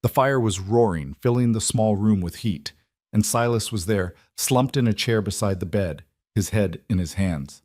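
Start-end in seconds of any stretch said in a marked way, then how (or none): none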